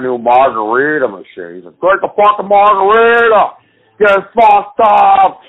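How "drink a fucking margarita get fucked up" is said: higher, louder, slow party-host urging; boozy host egging everyone on; hammed-up drunk Tim Allen. Tim Allen pushing margaritas on the boaters, mock drunk.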